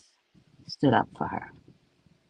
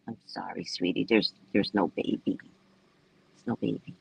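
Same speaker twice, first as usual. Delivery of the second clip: gentle consoling; 9 semitones lower, 7 dB quieter than usual. Retold or imitated. Staff gently consoling her.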